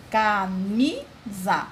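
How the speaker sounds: "camisa" very slow, as ca, mi, sa; syllable by syllable. Shown as a demonstration. Teacher letting pupils hear the strong "mi", "camisa" slowly split into syllables. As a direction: sound out "camisa" in three separate beats. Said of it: "o mi é a sílaba mais forte".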